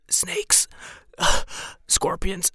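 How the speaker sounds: breathless, whispered fear; frightened whisper. Acted. A bitten woman whispering to the party in fear. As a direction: whisper it like she can barely speak. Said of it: "And she's like".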